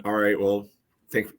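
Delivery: ordinary delivery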